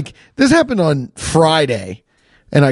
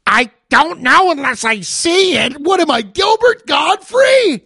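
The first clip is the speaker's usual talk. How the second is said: mocking mechanic; 11 semitones higher than usual. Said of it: "and you go".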